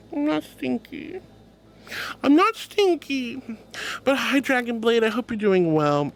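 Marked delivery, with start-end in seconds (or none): 0.1-1.2 high, drawn-out small protest; mock-hurt protest. Streamer answering a chatter's stinky accusation, softer mock-hurt denial. 2.2-3.4 high, drawn-out indignant denial; insistent mock protest. Streamer denying being stinky to chat, insistent mock protest. Protesting again, more forcefully.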